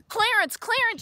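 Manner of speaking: calling out loud and high, breathless with news; excited calling out. Acted. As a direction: shout his name across the clearing, excited. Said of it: "she cried".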